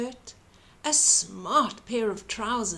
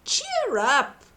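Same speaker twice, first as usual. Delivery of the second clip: cheery comfort; 4 semitones higher, 4 dB louder than usual. A narrator's reading. Giant comforting the giraffe.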